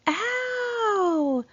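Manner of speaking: high shouted call of a name; yelled name. Retold or imitated. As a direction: call out his name loud and high across the street. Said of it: "Missy yelled".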